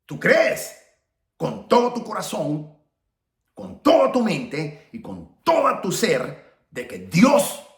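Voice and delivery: emphatic, drawn-out challenge to the congregation; big pulpit delivery with long pauses; impassioned preaching. Acted. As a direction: preach it forcefully, leaning on key words.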